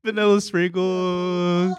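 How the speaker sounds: high, slow dreamy craving. Pretend. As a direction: savor each word slowly. Dreamy food list.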